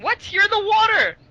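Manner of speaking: high, incredulous yell; shouting back. Acted. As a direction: holler back at the captain. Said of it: "shout back to the captain mentally".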